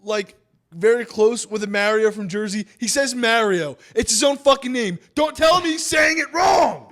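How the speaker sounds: high, indignant insistence building to a shout; hyped rant. Real, not acted. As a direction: argue it heatedly, rising to a yell. Hyped rant defending the pronunciation.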